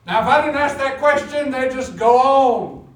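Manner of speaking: loud, high pulpit delivery; emphatic preaching. Real, not acted. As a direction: belt it out like a preacher, stretching the end. Preaching at full force.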